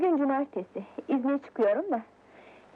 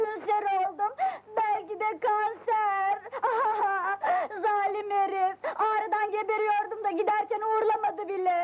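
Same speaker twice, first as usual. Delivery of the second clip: melodramatic sobbing; 6 semitones higher than usual. Acted. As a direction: wail it out like a soap heroine. Melodramatic sobbing over her fiancé.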